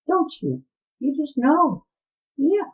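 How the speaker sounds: high sing-song voice for the dog. Real, not acted. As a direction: coo at the dog in a lilting pitch. Talking to her dog Diamond.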